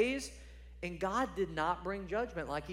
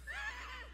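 short laugh; 10 dB quieter than usual. Real, not acted. Laugh between quoted lines.

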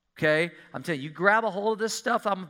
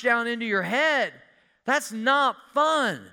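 loud, higher emphatic preaching; 8 semitones higher, 5 dB louder than usual. Acted. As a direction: drive it home like a pulpit preacher. Preaching at full force.

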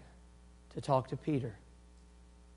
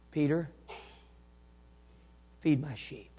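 gentle forgiveness; 6 dB quieter than usual. Acted. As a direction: reassure him quietly, with space after the name. Gentle Jesus after imagined rebuke.

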